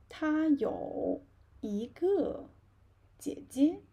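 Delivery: slow, clear reading for repetition; teacher reading aloud slowly, loud and clear; slow model reading. Shown as a demonstration. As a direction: read it slowly so they can follow. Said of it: "Read after me".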